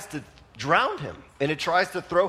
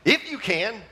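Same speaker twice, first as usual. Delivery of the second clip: with attitude; 7 semitones higher than usual. Retold or imitated. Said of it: "Jesus kind of responds with an attitude".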